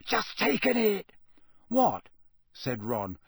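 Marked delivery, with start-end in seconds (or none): 0.0-1.1 triumphant whisper. A narrator's reading. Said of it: "in a triumphant whisper". 1.7-2.1 surprised. A narrator's reading. Ron surprised in story.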